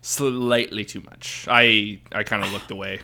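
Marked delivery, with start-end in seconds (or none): 0.0-1.5 very slow, stretched-out agreement; drawn-out echo. Real, not acted. Echoing the co-host's "slightly too much" question, drawn out. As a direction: stretch out the first word for emphasis.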